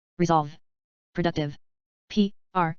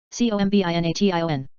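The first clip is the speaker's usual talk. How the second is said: quick letter run; brisk recap spelling; fast spelling; faster than usual. Shown as a demonstration. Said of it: "spelling drill, slow then fast".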